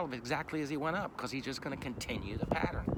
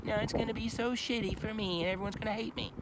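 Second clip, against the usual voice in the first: higher, louder whiny complaining, mocking Cain; mock the self-pitying murderer's whine; 8 semitones higher, 3 dB louder than usual. Preacher mocks Cain's whiny self-pity to the congregation, whining. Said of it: "But Cain's all whiny".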